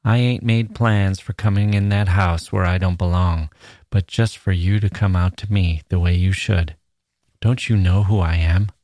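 plain reading voice

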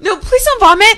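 high panicked plea; panicked pleading. Real, not acted. Begging friend not to vomit.

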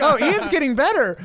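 high, loud mock cheer; mocking chat. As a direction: tease like a crowd of viewers. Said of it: "a thousand people in chat saying that".

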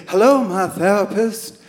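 mock the idea of therapy with a sing-song hello. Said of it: "mocking the whole therapy thing".

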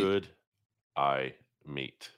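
each word apart, low and flat; reluctant word-by-word reading with long gaps; deliberately slow. Shown as a demonstration. Co-host dodging the accent trap, deliberately slow. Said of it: "at normal speed".

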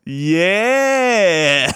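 long, high drawn-out cry; hammed-up lone whoop of agreement; mock self-cheer. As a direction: imitate a heckler cheering herself on, stretched out. Heckler answering herself.

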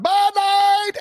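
high yelled Bud Light call; stadium vendor hawking. Shown as a demonstration. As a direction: hawk it like a ballpark beer vendor. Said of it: "I'm not doing a very good beer vendor today".

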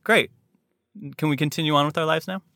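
unimpressed, get on with it; mock indifference, hurrying past the big moment; bored shrug. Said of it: "this feeling of".

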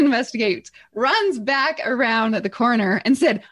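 usual speaking voice

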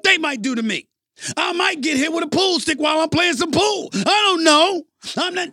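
escalating comic panic over the money; excited rant. Real, not acted. Escalating rant about risk.

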